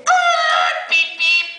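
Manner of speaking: very high squeal then beeping; imitating the youth worker tooting the horn; mock horn beeps. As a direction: honk like a van horn.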